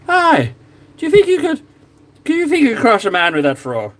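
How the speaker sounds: put-on high, far-off Scottish accent that slips Irish; quoting Braveheart in a Scottish accent drifting Irish. Painting minis, quoting Braveheart in a Scottish accent. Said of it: "My Scottish accent turns a little Irish".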